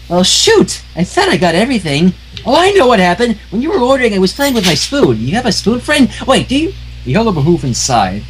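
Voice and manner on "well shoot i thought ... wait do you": high, bouncy cartoon pony voice; high, giddy pony chatter; bubbly Pinkie Pie. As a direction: chirp it like an overjoyed pink pony.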